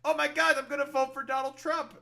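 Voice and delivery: high, loud mock enthusiasm; mocking gushing voter. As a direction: gush loudly like an eager fan, mocking. Mocks gushing Trump voters.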